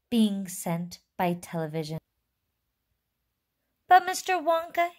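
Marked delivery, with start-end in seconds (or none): 3.9-4.9 high, loud, eager call; excited shout. A narrator's reading. Eager boy calls out to Mister Wonka, high excited shout. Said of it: "he shouted".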